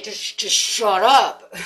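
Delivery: slow, loud, dissolving into laughter; mock order broken by laughter; laughing shut up. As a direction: laugh while telling yourself off. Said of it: "your intuition's over here like".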